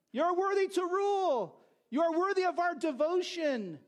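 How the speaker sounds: high, ringing chant of praise; yelled herald acclamation. Acted. Heralds praising the emperor, high yelled acclamation. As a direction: proclaim it like a herald to a crowd. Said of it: "they would yell out".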